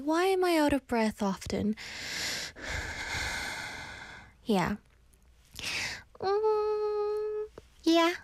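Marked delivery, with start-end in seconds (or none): 1.8-4.0 long heavy sigh. Real, not acted. Sighs over her breathing problem. 6.2-7.4 high whimpering hum. Real, not acted. Reacts to her own question.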